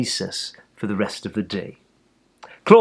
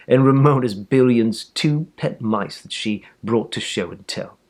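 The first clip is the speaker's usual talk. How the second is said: usual speaking voice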